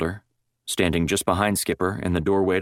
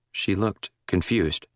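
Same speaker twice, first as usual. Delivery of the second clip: usual speaking voice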